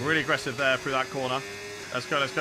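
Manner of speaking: normal talking voice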